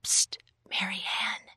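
urgent, hushed whisper. A narrator's reading. Rachel secretly calling her friend from behind a wagon, whispered. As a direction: whisper to get her attention.

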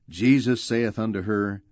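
ordinary delivery

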